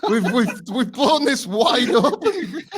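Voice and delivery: high, slow words through laughter; cracking up, struggling to get it out; helpless giggling while talking; laughing hard. Real, not acted. Cracking up at own joke.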